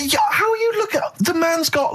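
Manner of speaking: excited. Real, not acted. Excited point about Jackson.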